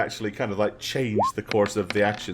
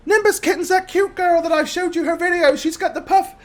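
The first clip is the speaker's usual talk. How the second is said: put-on very high, louder child voice; excited little girl; 4 dB louder than usual. Granddaughter describing her idol to grandma, excited. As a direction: gush like a young fan.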